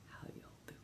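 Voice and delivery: barely audible whisper; whispered greeting. Real, not acted. Streamer checking in on viewers, faint whisper. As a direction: drop it right down to a whisper.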